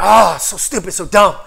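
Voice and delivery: loud, acted self-reproach; mock anger. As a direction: berate yourself in hammed-up frustration. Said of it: "You get mad".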